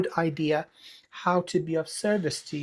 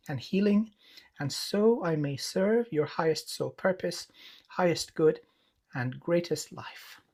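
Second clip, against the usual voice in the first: soft blessing invocation; 2 semitones lower, 4 dB quieter than usual. Acted. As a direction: intone the blessing softly like a prayer.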